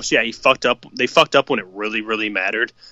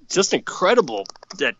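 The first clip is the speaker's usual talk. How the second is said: normal talking voice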